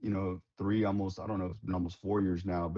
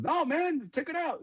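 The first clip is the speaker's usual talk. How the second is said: much higher, insistent imitation; mock sergeant major. Quoting the sergeant major insisting they cook all the fish, much higher. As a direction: play the sergeant major waving off the objection.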